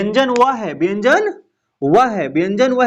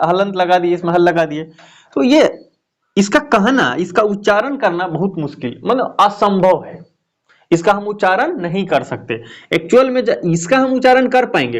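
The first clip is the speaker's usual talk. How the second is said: usual speaking voice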